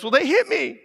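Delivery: lightly voiced whiny kid, high; child voice. Child blaming sibling, in a high whiny kid voice. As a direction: whine like a little kid. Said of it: "one of them goes".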